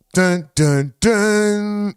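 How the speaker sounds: loud mock suspense notes; a loud, hammed-up dun dun dun; dramatic dun dun. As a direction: sing a mock reveal sting. Sound effect for reveal.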